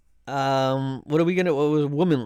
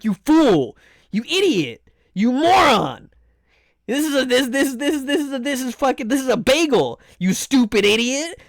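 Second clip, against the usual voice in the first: mock scolding; 5 semitones higher, 3 dB louder than usual. Mocking the person who insists on foods.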